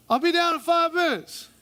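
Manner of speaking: lightly hammed-up wife; wife calling from upstairs. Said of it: "When she says".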